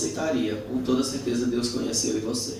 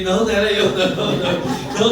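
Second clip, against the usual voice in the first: high, loud protest; insistent denial; 7 semitones higher, 6 dB louder than usual. Acted. Peter denying he knew him, loudly protesting. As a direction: deny it hotly.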